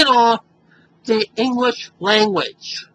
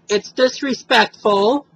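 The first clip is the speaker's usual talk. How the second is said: ordinary talk, nothing marked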